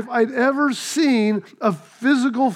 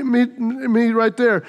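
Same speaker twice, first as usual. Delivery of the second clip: ordinary delivery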